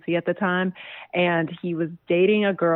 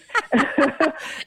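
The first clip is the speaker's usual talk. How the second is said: a short laugh that climbs high; amused laugh; 10 semitones higher than usual. Real, not acted. Guest laughing about the not-a-date story, high amused laugh. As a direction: laugh at your own joke, bright and high.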